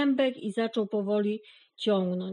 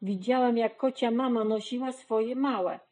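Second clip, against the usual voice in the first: plain reading voice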